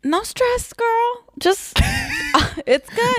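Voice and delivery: high, airy, cheery falsetto; way up high and breezy, brushing it off; sing-song chill reply. Retold or imitated. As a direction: falsetto no-worries voice. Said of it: "And I was like".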